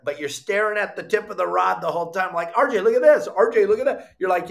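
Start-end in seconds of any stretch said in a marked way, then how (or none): 2.6-4.1 excited calling. Retold or imitated. Eagerly calling to RJ, mock retold.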